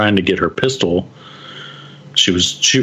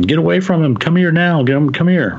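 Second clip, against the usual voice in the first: high, urgent mock yell; retold yelling; 9 semitones higher than usual. Mom calling her child away from danger, high retold yell. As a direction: imitate mom calling out a warning, pitched well up. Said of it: "and she's yelling".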